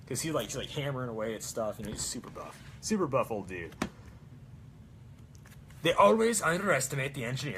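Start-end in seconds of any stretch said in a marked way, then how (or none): 5.8-7.6 put-on Swedish accent. Cosplayer quoting Torbjorn's Swedish accent line. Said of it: "That's the Swedish accent".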